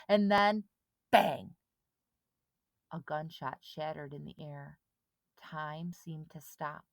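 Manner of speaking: ordinary delivery, nothing marked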